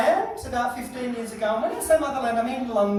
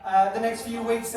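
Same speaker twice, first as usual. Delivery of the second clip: ordinary talk, nothing marked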